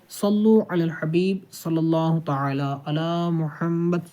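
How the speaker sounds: slow, reverent durood recitation; devotional blessing. Real, not acted. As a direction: chant the blessing slowly and devoutly. Closing blessing on the Prophet. (درود شریف عقیدت سے آہستہ)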